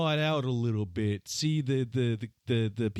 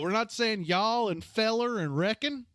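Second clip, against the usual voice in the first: higher, put-on Southern accent; teasing, exaggerated Southern talk; mock Southern accent; 7 semitones higher than usual. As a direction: play up a Southern twang in mock protest. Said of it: "Southerners do sound like Southerners".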